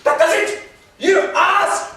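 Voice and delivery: very high, loud outburst; explosive indignation; furious shout. Acted. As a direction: lose it and shout. Patience snaps in quarrel.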